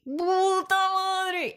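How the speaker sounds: grito agudo, muy lento y arrastrado; desperate yell. Retold or imitated. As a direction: estira el grito con desesperación. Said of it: "empiezo como a gritar de desesperación". (grito de desesperación, agudo y estirado)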